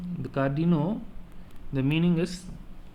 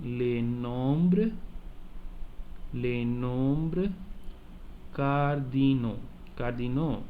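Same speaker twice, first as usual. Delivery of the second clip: les nombres and cardinaux, slowed; slow model for repeating; slower than usual. Shown as a demonstration. Teacher has class repeat the title, slowed. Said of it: "please repeat the title once again".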